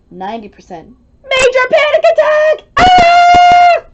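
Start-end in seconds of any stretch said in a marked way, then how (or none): 1.2-3.8 very high, very loud screaming; hammed-up terrified shriek; full panic scream. Panic-o-meter hits ninety percent.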